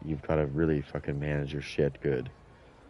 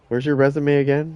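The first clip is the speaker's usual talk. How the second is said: mock impressed; 9 semitones higher, 8 dB louder than usual. Mocking coworkers suddenly impressed.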